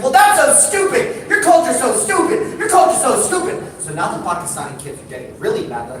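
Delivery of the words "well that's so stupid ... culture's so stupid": put-on high, loud childish insult; kid insulting. Palestinian kid taunting the brothers, high and bratty. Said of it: "It's like".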